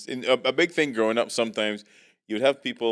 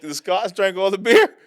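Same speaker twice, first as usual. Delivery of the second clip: put-on Scottish accent; 11 semitones higher, 9 dB louder than usual. Mimicking Scottish fans.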